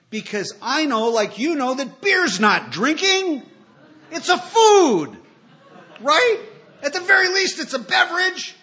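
hammed-up drunk logic, building to a shout; mock indignant insistence. Defending his drinking to the audience, mock outraged shout. Insisting beer is food, laughing.

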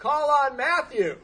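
high, loud, slow laughing call; loudly volunteer him, laughing; mock call-out. Sponsor calls him out in meeting.

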